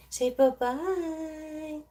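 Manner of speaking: slow, high coaxing say bye-bye; drawn-out sing-song prompt to a pet; coaxing baby talk. Acted.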